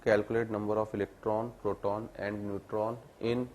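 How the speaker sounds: teacher reading a question aloud for students to copy, slowly; slow dictation. Shown as a demonstration. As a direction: question read out word by word, drawn out.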